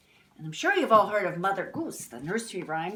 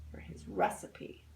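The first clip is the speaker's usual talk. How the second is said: ordinary delivery, nothing marked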